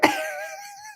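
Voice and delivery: shrill burst of laughter; high laugh. Real, not acted. Host laughing in disbelief at the scene, high laugh.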